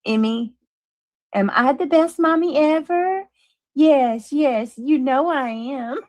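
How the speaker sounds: mock baby talk. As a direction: coo like a showy mom asking for praise. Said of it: "we gotta do the baby voice".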